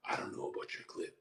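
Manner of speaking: quick, near-whispered aside; imitating a quick, whispery comment; hushed mock remark. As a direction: say it fast under your breath. Imitates saying it to her.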